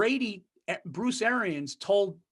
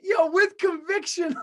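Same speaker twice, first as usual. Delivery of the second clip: laughing mock emphasis; 11 semitones higher, 5 dB louder, slower than usual. Real, not acted. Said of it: "mocking Bill's conviction".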